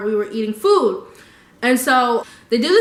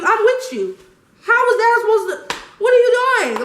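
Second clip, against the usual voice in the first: high, exasperated, voice pitched up; exasperated disbelief; 11 semitones higher, 4 dB louder than usual. Real, not acted. Venting at a friend who asked her for help, exasperated. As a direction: throw your hands up at her.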